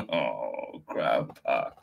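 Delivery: usual speaking voice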